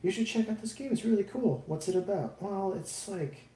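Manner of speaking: high-pitched influencer pitch, petering out; D-list influencer. Acted. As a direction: put on a hammed-up, high influencer voice. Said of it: "I'm like a D-influencer".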